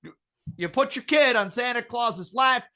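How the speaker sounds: ordinary talk, nothing marked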